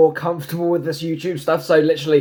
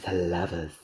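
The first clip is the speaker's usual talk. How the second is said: a low eerie whisper of the Lovers; spooky low voice; 10 semitones lower, 10 dB quieter than usual. Acted. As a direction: intone it like a spooky fortune teller. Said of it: "I don't like it when I do that voice".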